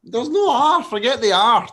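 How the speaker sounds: usual speaking voice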